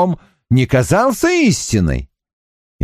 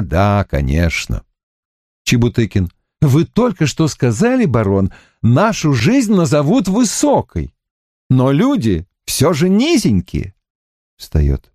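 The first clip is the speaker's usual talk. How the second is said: ordinary delivery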